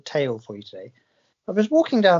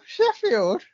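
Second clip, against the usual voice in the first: high mock surprise; 12 semitones higher than usual.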